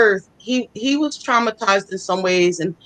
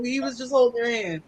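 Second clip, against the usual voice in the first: ordinary talk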